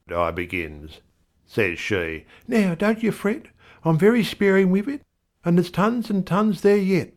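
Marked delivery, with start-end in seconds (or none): 2.5-7.1 much higher, fast wife voice; a fussing wife, higher; wife's voice. A narrator's reading. Wife soothing her fretting husband, higher.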